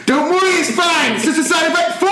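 very high-pitched, hammed-up yelling; comically overbearing loud encouragement; mock shouted reassurance. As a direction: imitate a helper bellowing comfort during a countdown. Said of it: "he was getting louder".

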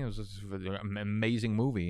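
ordinary delivery

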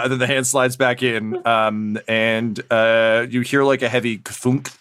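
ordinary talk, nothing marked

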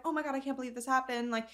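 ordinary talk, nothing marked

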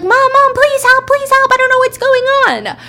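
very high, loud acted child scream; screaming kids. Kids locked in the car screaming to mom, terrified. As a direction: shriek for help like a trapped child. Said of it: "her kids who are screaming".

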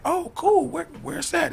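high sweet fake-casual; high mock innocent voice. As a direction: play dumb in a high voice. Retold friend chat, jump in pitch.

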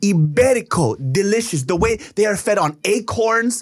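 high, hammed-up Italian salesman accent. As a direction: sell it like a showy Italian deli man.

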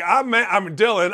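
usual speaking voice

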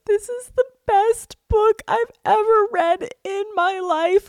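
very high, crying voice; mock sobbing wail. Retelling her reply to boyfriend, mock sobbing wail. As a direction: wail it slowly as if bawling, hammed up. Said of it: "I'm, like, hysterically sobbing".

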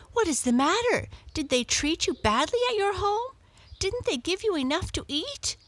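a neighbour cat fretting over her friend; concerned cat. A narrator's reading. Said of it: "cried the other cat".

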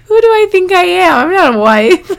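high giggling through words; laughing. Real, not acted. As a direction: laugh while talking, can't stop. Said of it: "I'm just laughing".

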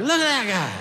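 high-pitched mock jeer; mocking pointing-out. Comedian showing how nobody ever said it, high mocking jeer. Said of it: "we never said it like that".

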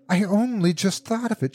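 high, hysterical outpouring. A narrator's reading. Wife to her husband after laughing and crying, hysterical. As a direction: let it spill out near hysteria. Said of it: "she said hysterically".

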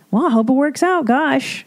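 high-pitched, breezy brush-off; mock sympathy. Listener dodging an oversharer's personal news, mock sympathy.

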